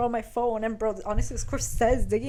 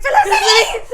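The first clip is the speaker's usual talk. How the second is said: high, loud mock scream of a name; hammed-up yell, laughing; 10 dB louder than usual. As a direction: screech it like a mother summoning her daughter. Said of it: "She starts yelling".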